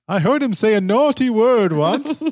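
put-on a sweet old lady telling a naughty secret, high. Asked to keep talking like the old woman, high and sweet. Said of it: "Could you talk like that for the rest of the time".

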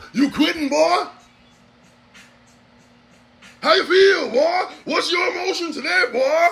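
high, drawn-up interrogation; hammed-up master pressing the slave; taunting slave master.

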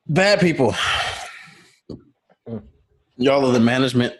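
talking between sips of a drink; while sipping a drink. Real, not acted. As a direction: drink in hand, mid-sip. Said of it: "Sorry, I'm drinking".

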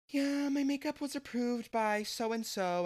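high, put-on smug reply; mock smug. Telling confused coworkers who approved the makeup, mock smug. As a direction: lift the voice high, showing off.

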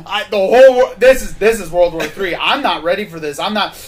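loud panicked string of curses; swearing tirade. Retold or imitated. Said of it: "and he's like".

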